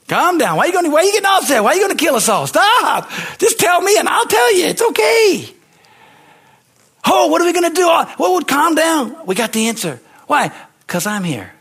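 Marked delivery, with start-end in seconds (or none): 0.1-5.5 high, loud urgent pleading; frantically soothing an enraged king; Daniel begs the furious king to calm down and just tell the dream. Acted. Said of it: "Be like Daniel and say". 7.0-9.2 high, loud hammed-up wailing; panicked royal advisors wailing before the king; mock panic.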